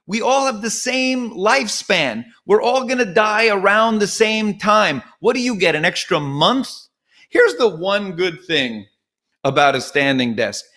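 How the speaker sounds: ordinary delivery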